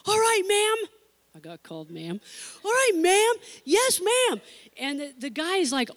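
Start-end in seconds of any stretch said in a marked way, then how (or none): 0.1-0.9 put-on a higher, louder voice for keen polite boys; eager teen boys. 2.6-4.4 put-on a higher, louder voice for keen polite boys; eager teen boys.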